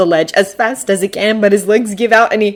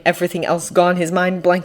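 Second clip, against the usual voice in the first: ordinary delivery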